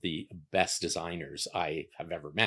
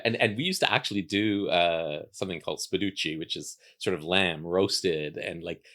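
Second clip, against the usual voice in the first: ordinary talk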